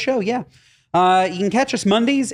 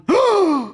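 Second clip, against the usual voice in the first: sharp, high gasp; startled gasp; 13 semitones higher than usual. Retold or imitated. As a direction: gasp as if suddenly spooked. Reenacts his startled reaction.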